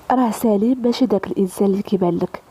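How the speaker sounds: ordinary delivery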